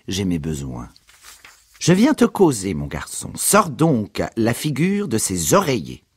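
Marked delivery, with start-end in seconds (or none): 1.8-6.0 higher mother voice, nagging; lightly voiced mother scolding; mother telling son to get up. A narrator's reading.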